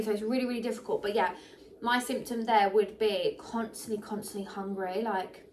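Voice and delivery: ordinary talk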